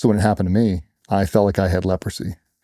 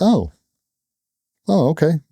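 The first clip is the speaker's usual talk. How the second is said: surprised realization; 8 semitones higher than usual. Retold or imitated. Strangers learn he's Lola's dad.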